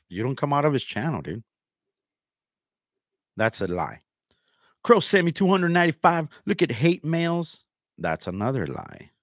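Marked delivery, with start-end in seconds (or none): 4.8-7.6 higher, louder mock claim; mocking imitation. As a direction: mimic him making a big fuss. Mimicking rival's claim, then rebutting.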